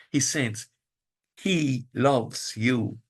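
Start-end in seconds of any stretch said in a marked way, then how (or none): 1.4-3.0 drawn out very slowly; slow and tender. Acted. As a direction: let each word land gently. Quoting Paul, very slow.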